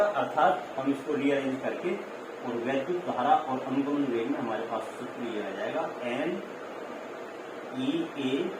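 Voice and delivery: ordinary delivery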